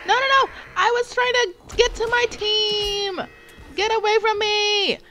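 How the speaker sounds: high, loud panicked shrieks; real panicked yelling. Real, not acted. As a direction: scream for help as the enemy closes in. Chased by zombie in game.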